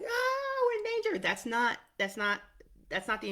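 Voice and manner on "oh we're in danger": much higher and louder, an alarmed squeal; hammed-up panicked cry of danger; mock panic. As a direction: voice the survival system freaking out. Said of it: "it's freaking our survival system out".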